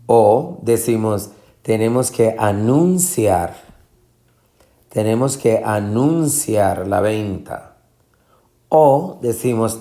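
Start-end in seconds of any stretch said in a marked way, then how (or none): 1.6-7.8 anunciar with NUN raised and lengthened; misplaced middle stress. Shown as a demonstration. Teacher trying a wrong option, a-NUN-ciar stressed in the middle. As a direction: lean hard on NUN in anunciar. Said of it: "No va a anunciar".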